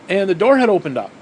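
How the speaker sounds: normal talking voice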